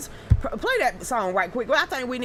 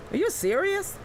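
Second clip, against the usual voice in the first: disbelief; 7 semitones higher than usual. Retold or imitated. Reacting to chat claims.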